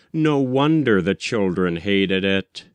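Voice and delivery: ordinary delivery, nothing marked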